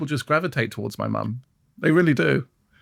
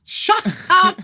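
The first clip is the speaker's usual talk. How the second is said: high outraged shut up; playful indignant cry; mock outrage. Real, not acted. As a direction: yelp at the teasing. Teased about age.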